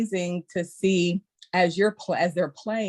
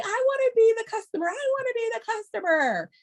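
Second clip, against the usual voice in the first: put-on begging kids.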